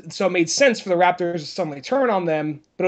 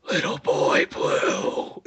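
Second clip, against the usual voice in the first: long, drawn-out creepy whisper; 4 dB quieter, much slower than usual. Acted. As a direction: whisper it very slowly into an ear.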